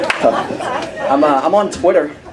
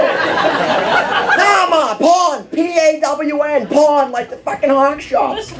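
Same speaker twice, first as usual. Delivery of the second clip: Boston son; 14 semitones higher, much slower than usual. Retold or imitated. Son shouting at his mother to explain in a thick Boston accent. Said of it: "real thick Boston accent".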